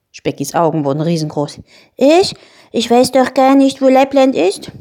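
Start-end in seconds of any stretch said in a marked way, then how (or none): 2.0-4.7 high, loud creature protest; startled, protesting he has no idea; alarmed little dragon. Acted. Spekulatius is told to fly to Lappland, alarmed.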